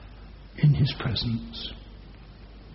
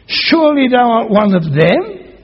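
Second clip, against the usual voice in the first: accusing crowd; 6 semitones higher, 10 dB louder than usual. Acted.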